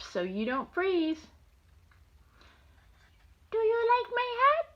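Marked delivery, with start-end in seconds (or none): 3.5-4.7 high, bright question as a dog; dog character voice. Acted. Grandma voicing a dog for her grandson, high and sweet. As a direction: squeak it like a little dog asking.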